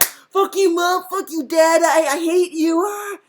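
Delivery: high-pitched mock tantrum; angry kid. Kid saying mean things to parents, mock angry. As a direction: play a child screeching at parents in a fit. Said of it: "you get very angry at your parents".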